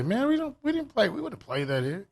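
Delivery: high mock voice of disapproval; mock disapproving elder. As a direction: play a fussy old-school chaperone turning the band down. Quoting an old-timer objecting.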